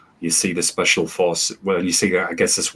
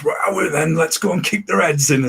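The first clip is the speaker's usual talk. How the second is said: higher, hammed-up rough northerner; mock northern thug; 9 semitones higher than usual. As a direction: joke voice of a brawler from up north. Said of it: "us barbarians up north".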